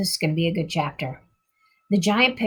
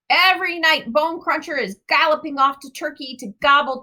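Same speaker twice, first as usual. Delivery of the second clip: friendly giant; 7 semitones higher than usual. A narrator's reading. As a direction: boast like a big clumsy giant. Giant voice continues.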